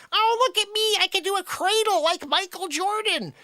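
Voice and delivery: very high, squeaky taunting imitation; mocking show-off. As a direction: parody his boast in a ridiculous high voice. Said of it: "You're like".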